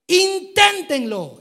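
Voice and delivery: Inténtenlo shouted high and slow, drawn out; a loud, slow Inténtenlo; exclaimed. Shown as a demonstration. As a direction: belt out Inténtenlo like an exclamation mark. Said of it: "Y está en signos de admiración".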